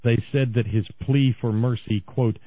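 usual speaking voice

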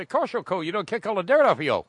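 put-on high, loud drunk Cajun buddy; rough Cajun accent. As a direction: scold him in a hammed-up Cajun accent. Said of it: "I can't do the accent".

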